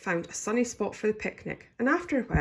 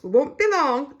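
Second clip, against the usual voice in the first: louder, higher announcing call; 8 semitones higher, 9 dB louder than usual. A narrator's reading. Granny telling the family they'll return, loudly. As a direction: call it out so all can hear. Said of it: "Granny said in a loud voice".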